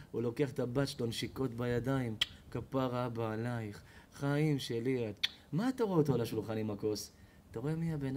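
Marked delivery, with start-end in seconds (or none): 2.5-5.3 lightly voiced tender drunk dad; tender father. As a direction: coo it slowly to your daughter. Drunk father kissing daughter.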